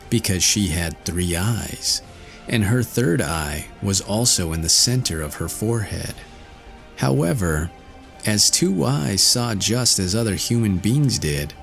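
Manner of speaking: ordinary delivery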